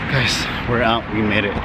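out of breath after running; panting between words; running out of air. Real, not acted. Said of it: "I'm running out of breath".

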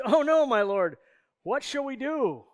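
mock panic with a wailed question; panicked. Servant crying to his master as the army surrounds them, panicked. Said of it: "he panics here".

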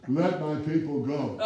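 a hint of Aaron announcing grandly to Pharaoh; Aaron proudly declaring the demand; bold Aaron. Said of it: "Aaron said".

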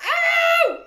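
huge high shout of pain; yell of pain. A narrator's reading. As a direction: yelp as if skin tears. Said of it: "yelled out".

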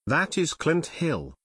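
plain reading voice

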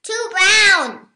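high loud squawk. A narrator's reading. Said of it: "squawked Rooster".

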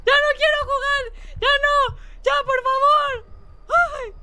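very high, pleading wail; panicked whining. Real, not acted. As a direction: beg to stop in a squeaky cry. Begs for game to stop.

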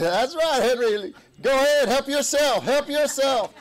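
high, loud whooping encouragement; re-enacted shout egging a friend on; exuberant cheering. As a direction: cheer him on at full voice. Retold cheering on happy Henry.

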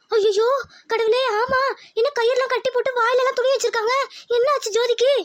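high-pitched, panicked dismay; horrified alarm. Acted. Relative sees the kidnapped girl's photo, horrified and high-pitched. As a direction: gasp it out in rising alarm.

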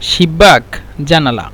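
ordinary delivery

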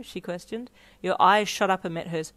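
ordinary delivery, nothing marked

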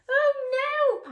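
high, loud panicked cry. Acted. The pineapples panicking in the dark, high and loud. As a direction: squeal in mock panic. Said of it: "panicked the pineapples".

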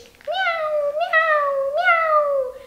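very high, tiny mewing; thin kitten meows. A narrator's reading. Two little kittens answering Krzyś, thin high meows. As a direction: mew like small frightened kittens. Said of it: "odpowiedziały mu cienkie głosiki".

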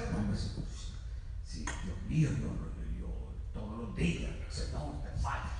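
soft, hushed confession of failing God; humble quiet prayer. Retold or imitated. Preacher imitating a humble prayer, hushed and contrite. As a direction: murmur it like a broken man alone before God. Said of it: "yo quisiera oír a alguien orando de esa manera".

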